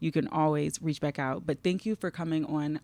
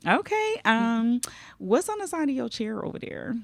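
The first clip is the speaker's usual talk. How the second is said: playful; 8 semitones higher than usual. Real, not acted. Teasing about hidden gifts.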